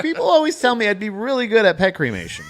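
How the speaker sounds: mock boast shout.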